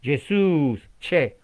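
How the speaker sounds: put-on Argentine accent. Teacher imitating an Argentine saying the name, Argentine accent. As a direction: put on an Argentine "zh" and add a che. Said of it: "si usted es argentino".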